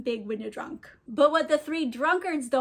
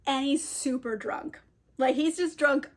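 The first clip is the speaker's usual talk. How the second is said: plain reading voice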